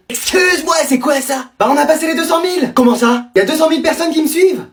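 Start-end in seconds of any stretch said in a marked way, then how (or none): none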